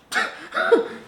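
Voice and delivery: sudden high giggle; high laugh. Real, not acted. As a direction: burst out laughing. Laughs at own joke.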